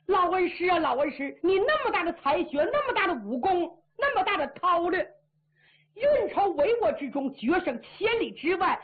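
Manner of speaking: put-on high, sarcastic pity for the old master; mock lament. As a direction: tease him like a fool who was fooled. Marshal mocks old master inwardly.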